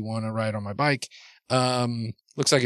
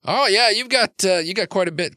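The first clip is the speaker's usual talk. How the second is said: high, louder admiring reaction; pleasantly impressed, pitched up; 10 semitones higher, 6 dB louder than usual. Acted. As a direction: react with raised pitch to a nice find. Character admiring the Trid package.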